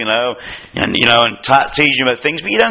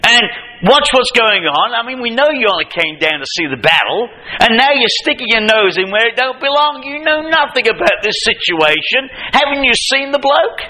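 higher, slowed scolding big-brother rant; Eliab scolding; 7 semitones higher than usual. Retold or imitated. Eliab berating David for coming down, higher scolding. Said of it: "He says to him".

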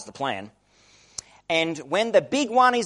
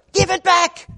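put-on higher, louder child's wail of give it back; imitating a whiny child; 12 semitones higher, 6 dB louder than usual. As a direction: cry it out like a kid robbed of a toy. Sibling tussle over toy.

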